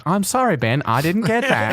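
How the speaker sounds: high-pitched mock Australian assistant voice; Siri's Australian accent. Voice assistant failing to understand its user, mock Australian accent. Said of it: "not the creepy Siri Australian accent".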